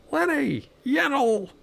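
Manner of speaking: a silly high-pitched yelp of a name; playful high call. Pretend. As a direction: call the name up high, then flip it backwards. Calling companion name backwards.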